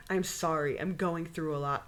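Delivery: soft, low retold apology; meek apology. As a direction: say sorry small and subdued. Retold apology after the fight.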